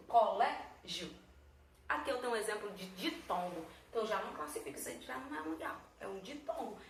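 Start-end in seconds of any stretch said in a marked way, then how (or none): 0.1-1.1 "Colégio" slowly, GIO kept as one syllable; syllable division. Shown as a demonstration. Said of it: "elas ficaram juntinhas na mesma sílaba". ("Colégio" devagar, sílaba por sílaba, com GIO juntinho)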